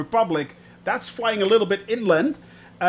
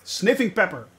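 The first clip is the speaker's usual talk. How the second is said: ordinary talk, nothing marked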